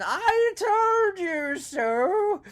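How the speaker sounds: high, very slow, loud scream. Acted. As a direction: yell it out, vindicated and terrified. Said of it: "And Biggs was screaming".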